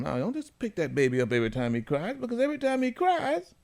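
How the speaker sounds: high scolding mom voice; imitating mom. Host quoting his own mother's advice, high mock voice. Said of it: "I was taught by my mom".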